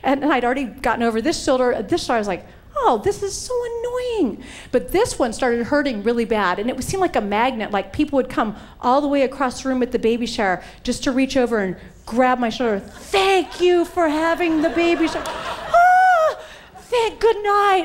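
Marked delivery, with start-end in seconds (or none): 2.8-4.4 high, slow whine; whiny complaint. Retold or imitated. Complaining about reinjured shoulder. 12.8-17.8 high, slow strained voice laughing through it; mock pained guest replies. Guests grabbing her sore shoulder.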